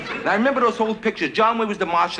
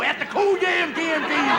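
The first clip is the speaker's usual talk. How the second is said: put-on high, slow, garbled old prospector; mumbling old-timer; 8 semitones higher, slower than usual. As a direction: slur it into nonsense like an old miner. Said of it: "Nobody understood a word the man ever said".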